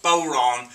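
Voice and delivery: "bodhrán" said as bow-RAWN; Irish pronunciation. Shown as a demonstration. Explaining the Irish drum's name, "bodhrán" pronounced bow-rawn. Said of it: "but pronounced bodhrán".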